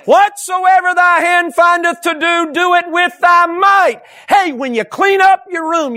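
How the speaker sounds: loud, high, slow scripture proclamation; full-force preaching. Real, not acted. Preacher driving home the verse on doing all with might, full force. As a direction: thunder the verse out to the congregation.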